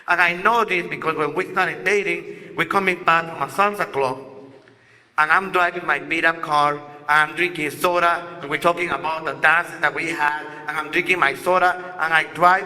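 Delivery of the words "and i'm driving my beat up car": plain reading voice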